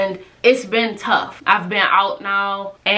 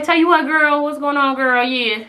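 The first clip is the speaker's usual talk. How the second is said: mock sweet sassy; 6 semitones higher than usual. Retelling cheerful reply to mom.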